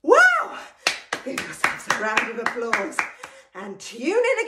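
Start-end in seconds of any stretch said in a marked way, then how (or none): 0.0-0.6 high, loud burst of "Wow!"; excited wow. Real, not acted. Warm-up leader cheers the group after the song, excited whoop. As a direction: whoop it out at the finish.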